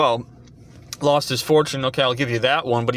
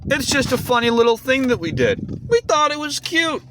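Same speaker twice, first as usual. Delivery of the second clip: mocking high, cutesy voice; Eric Kripke; 11 semitones higher than usual. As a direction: mimic him sweetly making light of it, pitched way up. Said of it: "And he says".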